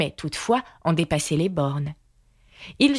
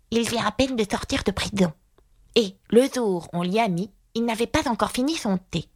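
higher character voice, confiding; murmuring confidant; 5 semitones higher than usual. A narrator's reading. Aïga tells Alice about Atta's prison, confiding. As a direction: pass on gossip in a raised, odd little voice. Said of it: "murmura Aïga à l'oreille d'Alice".

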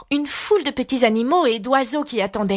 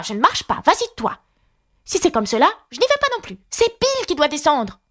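high, squabbling character voices; animaux qui se disputent; 7 semitones higher than usual. A narrator's reading. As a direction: bicker over who goes, pitched well up. Animals argue over the chimney. (chamaillerie en voix aiguës)

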